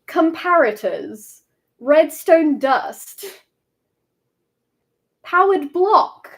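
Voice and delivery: drawn-out British-voiced impression, word by word, swooping up and down; Mumbo. Streamer listing Mumbo's phrases in audition, slow playful impression. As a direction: list each item slowly in a put-on British character voice. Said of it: "what else does Mumbo say?".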